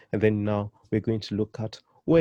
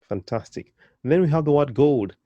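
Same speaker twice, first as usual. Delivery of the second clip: usual speaking voice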